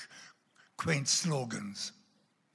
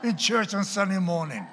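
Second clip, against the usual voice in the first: high, loud laughing warning; loud joking warning; 9 semitones higher, 12 dB louder than usual. Real, not acted. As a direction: warn them loudly with a laugh.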